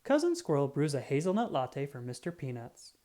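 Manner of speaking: ordinary delivery, nothing marked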